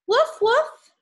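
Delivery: high yappy puppy woof; puppy bark. Acted. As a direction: bark like a small happy dog. Dog barks in the story.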